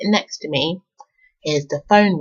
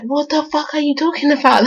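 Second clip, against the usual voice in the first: disbelief; 8 semitones higher than usual. Retold or imitated. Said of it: "I'm like".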